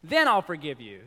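slow, loud, high haughty demand; mock demanding. Preacher mocking a grudging forgiver, slow haughty demand. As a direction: make them grovel first. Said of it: "So I'm not gonna be like".

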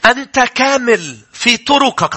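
slow, weighty verse; slow solemn scripture reading. Shown as a demonstration. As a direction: read it out slowly and solemnly. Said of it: "حزقيال اثنين وعشرين".

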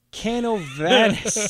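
Canovanas slowed right down, syllable by syllable; careful place name. Shown as a demonstration. Host stumbling over a Puerto Rican town name, slowly. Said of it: "Canav-, Can".

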